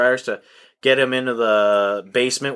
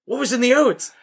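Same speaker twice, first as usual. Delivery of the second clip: much higher hammed-up dad voice, baffled; mock sheriff dad; 11 semitones higher than usual. Sheriff asking his son what was in the oats, hammed-up and high. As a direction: play the bewildered dad.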